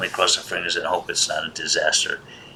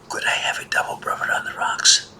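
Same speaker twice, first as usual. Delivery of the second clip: whisper. Retold or imitated. Hushed after library rebuke.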